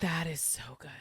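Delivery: soft breathy whisper of awe; whispered that is so good; awed whisper. Real, not acted. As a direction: breathe it out, moved. Moved reaction to a profound point.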